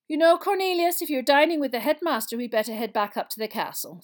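loud, high start, then settling; lightly voiced practical professor; Professor McGonagall, brisk. Professor urges minister back to the castle, briskly.

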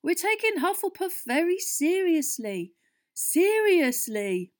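high, loud, last word drawn out; insist, then stretch the repeat slowly; emphatic insistence. A narrator's reading. Fred placating the alarmed captain, loudly emphatic.

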